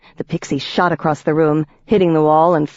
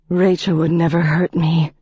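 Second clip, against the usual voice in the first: ordinary delivery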